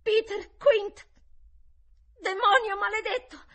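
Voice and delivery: high, furious shout; loud, frenzied boy's outburst; convulsed cry. A narrator's reading. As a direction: scream the name and the curse. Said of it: "la supplica convulsa".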